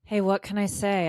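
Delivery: ordinary delivery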